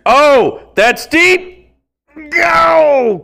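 play-by-play excitement; excited call. Real, not acted. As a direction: call the ball out as it flies. Calling a deep fly ball.

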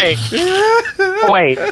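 high, cracked cartoon voice; squeaky put-on voice. Joking with friends on the podcast, squeaky cartoon voice. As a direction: squeak it out in a silly character voice.

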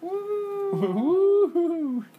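high cheering whoop; excited whoop. Real, not acted. Cheering the episode number.

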